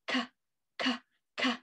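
"K, k, k" as short breathy k bursts; k sound. Shown as a demonstration. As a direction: click out the hard k sound. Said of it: "what does C say".

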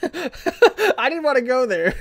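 high laughing mimicry, slow; mock the line while laughing. Mocking high laughing repeat.

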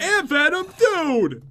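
high, loud hail; calling out. Real, not acted. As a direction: call excitedly to a buddy. Calling out to Ant Venom.

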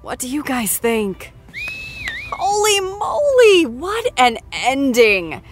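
ordinary delivery

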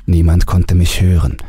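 plain reading voice

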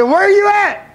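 very high, loud demand; crying out. Retold or imitated. Man calling on God for help, crying out. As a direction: throw the question up high and hard. Reliving his cry to God.

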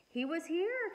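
put-on mildly excited girl; excited exclamation. A narrator's reading. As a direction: exclaim it with joy. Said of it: "Cassie exclaimed".

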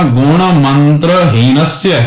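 very slow, ਹੀਨਸਯ ending in sye; don't clip it to heenas; ਹੀਨਸਯ in full. Shown as a demonstration. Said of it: "ਹੀਨਸ ਨਹੀਂ ਪਾਠ ਕਰਨਾ, ਹੀਨਸਯ ਪਾਠ ਹੈ".

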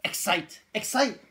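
high, loud burst of hype; excited. Shown as a demonstration. Co-host hyping up the product name on camera, excited. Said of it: "You gotta be exciting in this video".

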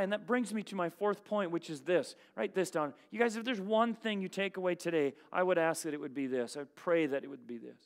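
usual speaking voice

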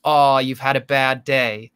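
slow mock sympathy in an American accent; put-on American voice, falsely sympathetic; pretend nice. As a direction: draw it out with fake kindness. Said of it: "pretends to be nice to me".

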